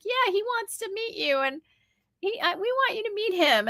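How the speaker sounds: high-pitched child's voice, retold; imitating eager kids. Children urging a woman to meet their father, high eager voice. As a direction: pipe up like a keen child.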